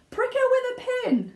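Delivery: very high, loud urgent cry; shouted. Acted. Another father shouts advice, frantic. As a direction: yell a wild idea. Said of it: "shouted one of the other fathers".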